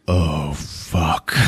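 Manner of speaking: low, soft "oh fuck", acted; imitated ominous realization; hushed dread. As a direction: let it sink in, low and quiet. Acts Stone's dawning dread.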